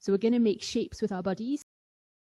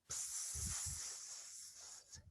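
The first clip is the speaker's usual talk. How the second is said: a soft breathy psst for a dog's wee; dog wee noise; 22 dB quieter than usual. Pretend. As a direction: make a cheeky little wee sound. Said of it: "have a little wee".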